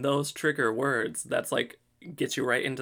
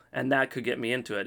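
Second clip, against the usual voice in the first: ordinary talk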